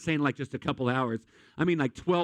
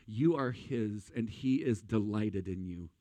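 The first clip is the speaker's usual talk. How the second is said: tender reassurance; 5 semitones lower, 5 dB quieter, slower than usual. Real, not acted. Tender assurance to congregation.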